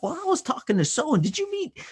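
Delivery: high, bubbly calling out to friends; excited partygoer. Retold or imitated. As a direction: greet everyone like the life of the party.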